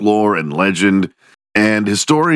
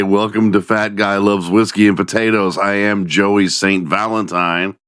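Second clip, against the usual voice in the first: ordinary talk, nothing marked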